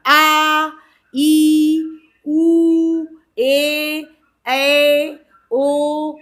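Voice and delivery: loud, held-out vowels; long vowels again. Shown as a demonstration. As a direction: draw each vowel out long. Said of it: "बोलने में हमें ज़्यादा time".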